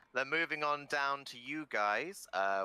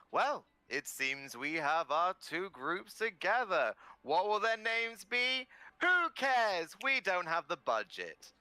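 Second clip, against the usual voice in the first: presenter voice; 7 semitones higher than usual. Acted. GM voicing the presenter.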